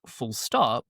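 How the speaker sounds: normal talking voice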